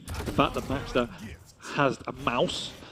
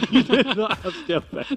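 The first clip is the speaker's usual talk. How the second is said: laughing; 8 semitones higher, 4 dB louder than usual. Real, not acted. Teasing co-caster after laughing.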